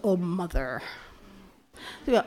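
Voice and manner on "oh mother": low, soft mock brush-off; retold dismissive reply. Daughter answering her mother's protest, playfully dismissive. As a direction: wave it away in a low voice.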